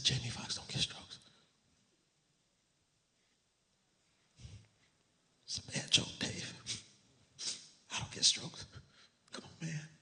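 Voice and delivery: hushed whisper, no voice at all; whispered denial. Retold or imitated. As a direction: breathe the words out in a whisper, stunned. Second take of the denial, whispered.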